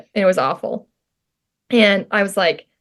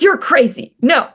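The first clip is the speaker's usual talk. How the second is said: raised, emphatic mock refusal; retold mean refusal; 6 semitones higher, 4 dB louder than usual. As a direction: turn the request down hard, in retelling. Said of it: "I was so mean to Janelle".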